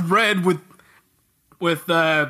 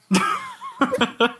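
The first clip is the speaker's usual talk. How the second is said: squeaky embarrassed laugh; high-pitched giggle. Real, not acted. Laugh after being caught recording.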